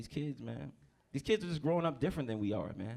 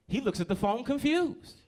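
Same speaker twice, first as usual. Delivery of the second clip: ordinary delivery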